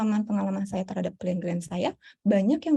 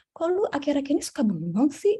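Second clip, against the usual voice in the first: puzzled friend's questioning; 6 semitones higher than usual. Retold or imitated. Voicing concerned people around you.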